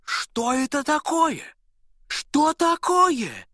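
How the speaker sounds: high, slow exclamation; startled cry. A narrator's reading. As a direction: shoemaker jolts up in shock. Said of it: "вскрикнул".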